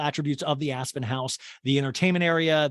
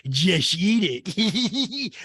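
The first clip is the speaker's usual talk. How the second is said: mock insistence; 4 semitones higher, 5 dB louder, much slower than usual. Mocking Rinna insisting.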